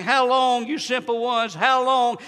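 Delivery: ordinary talk, nothing marked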